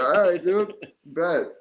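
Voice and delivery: high, loud easy "bet"; retold agreement. Agreeing with a stubborn friend, high loud casual. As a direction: shrug and go along with it.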